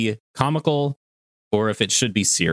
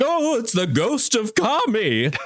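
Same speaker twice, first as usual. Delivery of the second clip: mock Popo fright; 10 semitones higher than usual. Mock Mister Popo freaking out.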